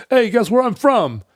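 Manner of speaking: put-on high, loud New York accent; brash New Yorker. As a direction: boast it like a cocky New Yorker. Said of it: "they'd walk in and they'd go".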